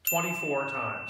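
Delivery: usual speaking voice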